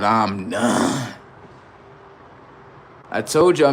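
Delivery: ordinary delivery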